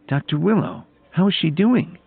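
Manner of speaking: high-pitched, worried question; mildly worried, voice lifted high; concerned. A narrator's reading. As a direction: ask anxiously after a patient. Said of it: "Adriana asked with concern".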